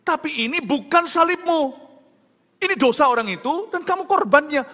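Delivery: high, loud, emphatic preaching voice; insistent retold rebuke, pitched high; impassioned protest. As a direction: drive each phrase home as a forceful objection. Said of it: "saya protes di hati saya".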